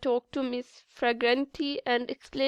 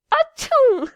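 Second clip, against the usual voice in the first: big loud high sneeze; enormous sneeze; 12 semitones higher, 9 dB louder than usual. Acted. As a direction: let rip a huge sneeze. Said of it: "the most enormous sneeze".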